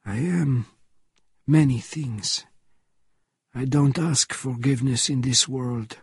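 a lightly voiced old Frenchman, grave. A narrator's reading.

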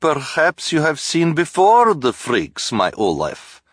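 put-on high, slow foreign accent; sweet fortune-teller with thick accent; Madame Lulu accent. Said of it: "Lulu said".

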